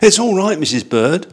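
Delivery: high, eager little bear; lightly voiced young bear. Paddington's voice in story.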